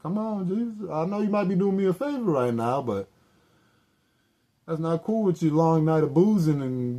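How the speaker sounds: high, loud mock-pleading voice; pleading with Jesus. Begging Jesus over the rain-soaked cigarette, high and whiny. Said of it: "I was like".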